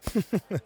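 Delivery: a short, high, breathy chuckle. Acted. Host laughs at guest's order.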